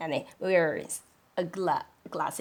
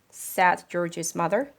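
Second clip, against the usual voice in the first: plain reading voice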